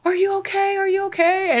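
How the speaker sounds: anxious, high retold concern; worried rescuer. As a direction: imitate a worried driver checking on someone distressed. Taxi driver finds her crying.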